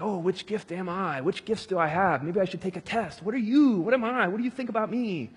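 imitating someone fussing over themselves; hammed-up self-absorbed wondering; mock fretful questioner. Mimics people fretting over their gifts.

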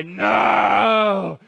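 long anguished shout; high, hammed-up howl of protest; loud, bitter cry. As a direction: wail it out at full voice. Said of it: "he let out a loud and bitter cry".

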